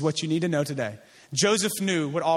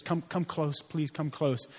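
hushed plea; 4 semitones lower, 7 dB quieter, slower than usual. Acted. Joseph speaking to his brothers.

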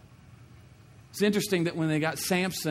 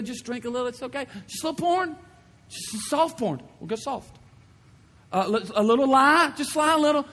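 high, loud, coaxing tempter building to frantic; the devil selling sin, ever higher and more eager; tempting devil; 10 semitones higher, 4 dB louder than usual. Acted. Voicing the enemy tempting sin.

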